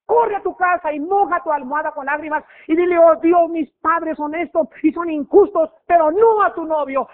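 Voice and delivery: loud, high pleading prayer; fervent pulpit plea; impassioned preaching. Real, not acted. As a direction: cry it out to God. Preaching at full force, voiced prayer.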